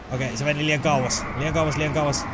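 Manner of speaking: ordinary talk, nothing marked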